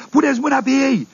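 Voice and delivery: mock crowd yell. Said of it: "urla lumea".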